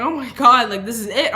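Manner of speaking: a loud burst of retold panic; panicked outburst. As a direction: blurt it out like you think this is the end. Panicking alone in the truck.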